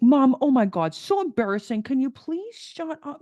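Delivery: put-on high-pitched, exasperated teen-style plea; mortified daughter. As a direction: imitate an embarrassed kid begging Mom to stop. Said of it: "she's absolutely humiliated".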